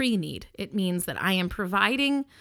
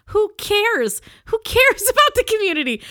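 much higher and louder, half-laughing; laughing outburst; 13 semitones higher, 9 dB louder than usual. Real, not acted. The storyteller exclaims, laughing, about the community. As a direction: burst out high and loud with a laugh.

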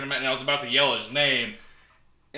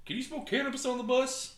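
high-pitched, softer mimicked voice; mock clueless guy; 11 semitones higher, 5 dB quieter than usual. Storyteller mimicking a guy asking about the bus, mock clueless. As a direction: put on a high voice for a silly question. Acting out a clueless guy's question.

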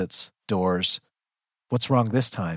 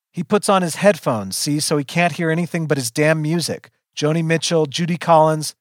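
raised, exasperated explaining; impatient yelling; 6 semitones higher, 4 dB louder than usual. A narrator's reading. Pinned narrator explains to the jerks, exasperated and raised. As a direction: explain loudly, out of patience. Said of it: "I am really yelling now".

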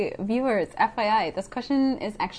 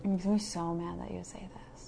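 near-whispered grumble; muttered complaint; 3 semitones lower, 14 dB quieter than usual. Real, not acted. Aside to co-host about the poll, hushed grumble. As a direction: say it very softly, almost whispered.

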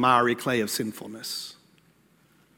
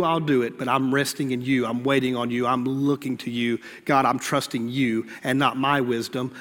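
low, softened voice speaking to God; earnest prayer; 5 semitones lower, 3 dB quieter than usual. Acted. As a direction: pray it quietly, in humble trust.

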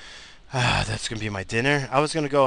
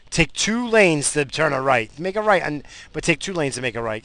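angry rant at a driver; 6 semitones higher, 9 dB louder than usual. Real, not acted. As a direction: yell at the bad driver. Ranting at another driver.

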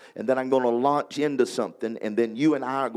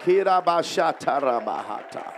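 fluent, raised ecstatic tongues; speaking in tongues; 4 semitones higher than usual. Real, not acted. Preacher leading worship in tongues, raised ecstatic.

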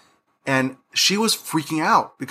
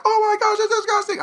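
high squeal through laughter; laughing disgust. Retold or imitated. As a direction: shriek it, laughing and grossed out. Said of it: "I'm like".

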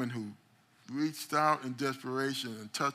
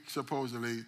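ordinary delivery

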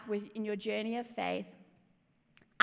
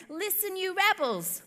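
higher, sharper exasperated call; frustrated shout; 8 semitones higher than usual. Acted. Moses rebuking the grumbling people, exasperated shout. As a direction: bark it at the grumbling crowd. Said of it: "he shouted".

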